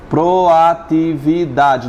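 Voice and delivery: "proatividade" slowly, each syllable apart; split into syllables. Shown as a demonstration. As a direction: step through "proatividade" syllable by syllable.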